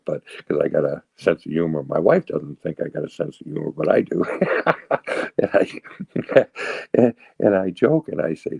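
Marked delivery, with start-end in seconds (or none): 4.3-5.3 breathy laugh at his own joke; chuckle. Real, not acted. Speaker amused at his wife doubting his humor, chuckling. 5.9-6.9 short, breathy follow-on laugh; chuckle. Real, not acted. Speaker trying to carry on after his joke, chuckling.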